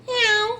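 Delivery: high squeaky imitation of a meow; mock cat meow. Neighbor imitating her vicious cat's warning meow, high squeak. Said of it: "And she goes".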